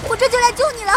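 high, urgent call across the battlefield; high call of hold on, I'm coming. Acted. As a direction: shout encouragement to a friend in trouble. Calling to a friend in battle.

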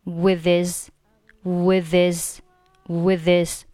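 "with his" as one unit, "wi-thiz"; linked reading. Shown as a demonstration. Teacher drilling again, "with his" linked without h. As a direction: drop the h and run "with" into "his". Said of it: "还是连读成with his".

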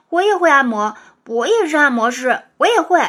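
put-on loud, excited children's voices; eager clamouring. A narrator's reading. Classmates all offer the teacher a massage, loudly eager.

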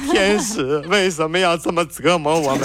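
拔高嗓子、拖长音假哭; 学生们委屈哭诉的夸张模仿; 假装哭诉. Acted. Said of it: "别哭，别哭，有话好好说啊". (带笑的夸张哭腔)

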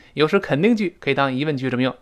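ordinary talk

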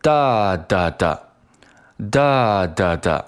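哒哒哒 slow, first 哒 long, two short; beating out rhythm. Shown as a demonstration. Teacher demonstrates the beat of the word, beating out rhythm. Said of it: "如果打拍子的话，那应该是".

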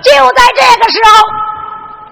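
slow, high, loud storyteller's call; drawn-out announcement. A narrator's reading. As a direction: stretch each word to grab the crowd. Storyteller building suspense.

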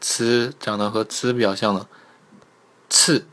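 ordinary delivery

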